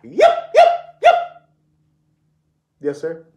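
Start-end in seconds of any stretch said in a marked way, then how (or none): 0.0-1.2 high yipping coyote barks, much higher and louder; coyote yips. Pretend. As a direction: yip like a coyote. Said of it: "began barking like a coyote".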